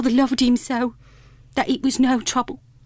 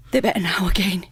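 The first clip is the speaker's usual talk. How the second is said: hushed, breathy whisper; 5 semitones lower than usual. Acted. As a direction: whisper it so the children won't hear. Mother hiding her tears from children.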